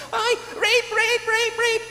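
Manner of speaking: very high, loud mock shriek; hammed-up falsetto cry for help; acted scream. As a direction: squeal it as a panicked woman in a joke. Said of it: "napasigaw si Inday".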